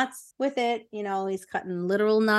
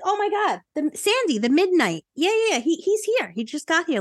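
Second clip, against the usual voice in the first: much higher, gushing, tumbling out fast; excited gushing; 8 semitones higher than usual. Acted. Court greets the returned midnight hero, thrilled and gushing. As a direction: gush like a starstruck fan.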